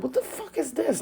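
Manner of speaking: high, incredulous retold reaction; baffled disbelief. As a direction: react bewildered at being blamed, pitch jumping up. Retold reaction to false blame.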